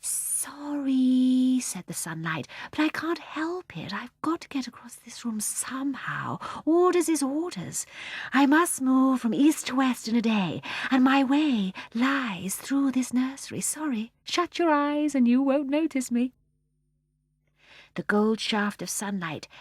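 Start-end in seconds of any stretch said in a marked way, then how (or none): 0.0-1.6 high, airy, long-drawn apology; apologetic sunlight. Acted. The sunlight apologises to John in a high, drawn-out voice. 2.7-16.3 high-pitched, airy character voice; apologetic sunlight. Acted. The sunlight excuses itself to John in a high, airy voice.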